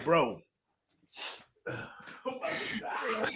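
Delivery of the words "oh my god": crying. Real, not acted. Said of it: "literally crying".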